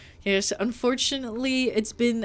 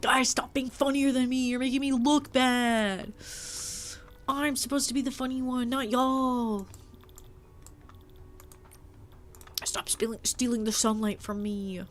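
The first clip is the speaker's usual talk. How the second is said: pitched-up mock complaint, a little whiny; mock whiny rant; 3 semitones higher than usual. Streamer whining at her funnier chatters, mock-indignant. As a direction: whine at the chat like a sulky star. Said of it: "I'm like".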